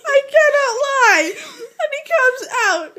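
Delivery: high, loud insistence through laughter; real laughing. Real, not acted. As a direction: insist while cracking up.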